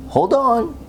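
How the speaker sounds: lightly voiced friendly guide; cheerful urging. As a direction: call him on merrily. Warning before flying.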